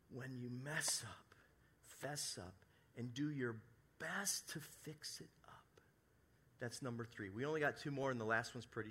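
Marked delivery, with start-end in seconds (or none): 0.1-5.8 slow unvoiced whisper of the motto; a hushed, deliberate secret; whispered rhyme. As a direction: lean in and whisper it slowly. Rhyming takeaway, whispered.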